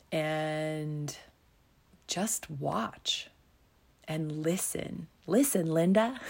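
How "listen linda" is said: put-on kid's bossy line. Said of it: "This little kid is like".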